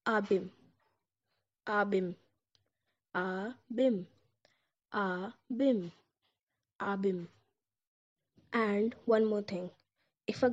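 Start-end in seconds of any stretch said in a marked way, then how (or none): 0.1-7.3 "Abim" slowed in the middle takes; Turkish abim. Shown as a demonstration. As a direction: say "Abim" as ah-bim.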